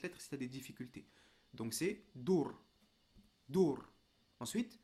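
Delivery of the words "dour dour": emphatic "dour", no vowel after the R; final R with soukoun. Shown as a demonstration. Teacher modelling a difficult letter, final R without vowel. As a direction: say "dour" loudly, closing on the R. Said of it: "le fait de lui mettre un soukoun".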